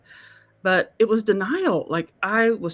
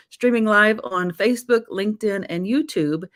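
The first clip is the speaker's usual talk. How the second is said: ordinary talk, nothing marked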